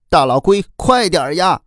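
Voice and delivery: high-pitched urgent cry to hurry up; desperate calling out. A narrator's reading. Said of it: "拼命地叫喊着".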